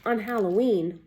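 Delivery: ordinary delivery, nothing marked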